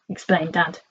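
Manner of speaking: ordinary delivery, nothing marked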